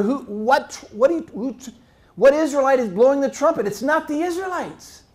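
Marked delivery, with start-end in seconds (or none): none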